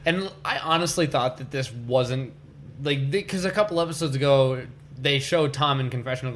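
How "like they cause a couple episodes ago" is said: ordinary talk, nothing marked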